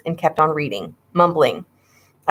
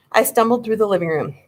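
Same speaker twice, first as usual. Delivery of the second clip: plain reading voice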